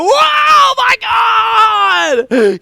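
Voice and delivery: very high, loud mock scream; hammed-up shriek of amazement; amazed scream. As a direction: scream it like an over-amazed commentator. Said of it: "He used to scream and be amazed".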